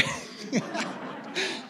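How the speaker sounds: airy laugh up high, no voice; high breathless laugh. Real, not acted. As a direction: let a delighted breathy laugh escape. Laughing at son's retort.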